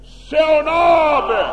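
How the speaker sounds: slow, high, full-voiced cry; loud shout. Shown as a demonstration. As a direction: shout it with real volume this time. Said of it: "Vähän ääntä". (kova huuto)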